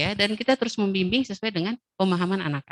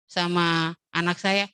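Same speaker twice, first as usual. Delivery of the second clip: ordinary talk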